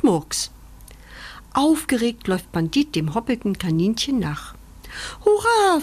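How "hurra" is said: high, loud happy "Hurra"; joyful cheer. Acted. Puppy cheering that he will find his mama, happy shout. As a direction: cheer with pure puppy joy. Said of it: "freut sich der kleine Hund".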